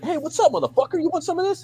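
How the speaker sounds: squeaky high mock threat, pitch way up; retelling a movie fight as a squeaky tough guy. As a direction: ham up a tough guy in falsetto. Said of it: "He's like".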